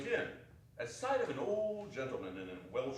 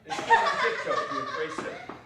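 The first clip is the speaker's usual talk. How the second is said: laughing; 10 dB louder than usual. A narrator's reading. Laughing narration of child.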